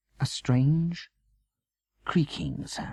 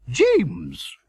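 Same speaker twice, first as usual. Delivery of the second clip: glad cry; 5 semitones higher, 5 dB louder than usual. A narrator's reading. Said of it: "Veronica cried".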